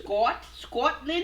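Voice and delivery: slow Scottish Scotland, rolled and drawn out; Scottish accent. Shown as a demonstration. To the streamer who asked, saying Scotland in a Scottish accent. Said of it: "That's how you say it?".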